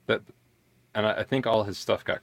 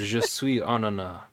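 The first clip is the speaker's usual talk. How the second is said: put-on slow, hammy French accent; deadpan fake French accent; mock French accent; 3 semitones higher, slower than usual. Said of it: "started speaking in a French accent".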